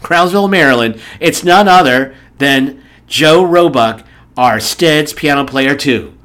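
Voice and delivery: loud, slow announcer build-up; big talk-show welcome; show host introduction. Acted. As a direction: draw out the guest's name, then a loud welcome. Announces the guest's name.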